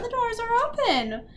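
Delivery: high-pitched gloating lilt; taunting villain. A narrator's reading. Sophie invites the beaten army to leave, taunting villain. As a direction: sing it out as a mocking invitation.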